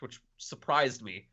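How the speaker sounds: usual speaking voice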